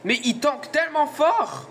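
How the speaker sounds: high, slow, cracking voice. Real, not acted. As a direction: let the voice crack as it goes. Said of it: "ma voix est en train de partir en couille".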